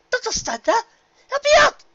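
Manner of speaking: very high, loud desperate demand; yelling for help; panicked shout. A narrator's reading. Rarity begging frozen pony.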